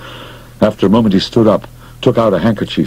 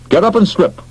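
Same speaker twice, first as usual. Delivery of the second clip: insistent command; 12 semitones higher than usual. Acted. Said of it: "he announced".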